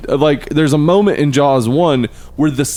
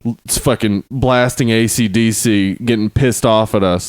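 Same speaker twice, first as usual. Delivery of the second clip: ordinary talk, nothing marked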